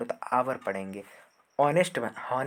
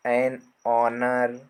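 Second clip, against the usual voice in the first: slow drill; 2 semitones lower, 4 dB louder, much slower than usual. Shown as a demonstration. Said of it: "teacher drills examples slowly".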